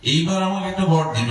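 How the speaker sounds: a hint of a desperate farmer begging; pleading husband. Shown as a demonstration. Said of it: "বুঝতে পেরেছেন তো?".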